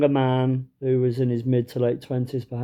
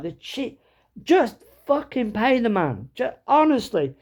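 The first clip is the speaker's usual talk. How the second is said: higher, drawn-out exasperation; open disdain; 10 semitones higher than usual. Retold or imitated. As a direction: blurt it out, fed up with the haggler. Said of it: "couldn't hide my disdain".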